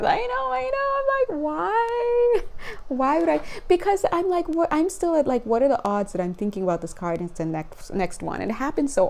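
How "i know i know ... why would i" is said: high mock protest. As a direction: squeak back at the guides. Retold reply to guides.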